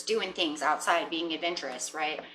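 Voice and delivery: normal talking voice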